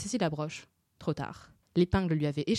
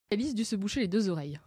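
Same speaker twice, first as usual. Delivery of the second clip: normal talking voice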